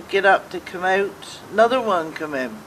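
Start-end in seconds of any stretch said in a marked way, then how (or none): none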